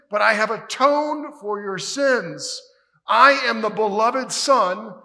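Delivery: loud, high, ringing proclamation; preaching at full force. Acted. Preacher proclaims Jesus' words to the congregation at full force. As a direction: proclaim it like a king from the pulpit.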